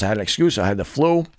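ordinary delivery

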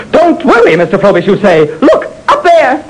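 frightened man mocking the reassurance; Frobish mocking. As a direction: throw the words back at him. Frobish mocks Jerry's words.

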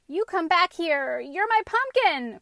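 loud, high indignant shout; crying out. A narrator's reading. Boy chasing his runaway pumpkin, indignant cry. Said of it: "cried Anton".

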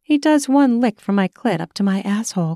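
ordinary delivery

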